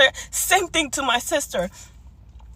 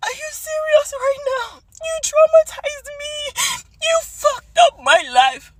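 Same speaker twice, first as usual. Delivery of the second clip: crying; 12 semitones higher, 3 dB louder than usual. Retold or imitated. Retelling a fight with her mom.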